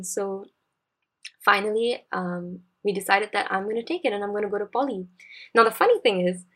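ordinary talk, nothing marked

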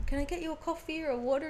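high-pitched mock polite offer; imitating eager crew member. Crew member at the trailer door offering drinks, imitated politely.

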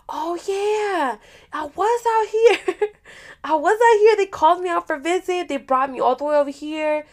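high-pitched mimicry of the guy; mimicking his reply. Him explaining he was called out for visit, high mocking imitation. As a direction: quote him in a high, put-on voice.

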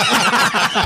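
bright burst of laughter. Real, not acted. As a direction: laugh at the joke. Joke about fake pansit. (tawang malakas)

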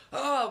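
high, loud whiny "oh"; a hammed-up, put-upon sigh of complaint; mock whiny groan. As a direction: groan like you're fed up. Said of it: "rather than going".

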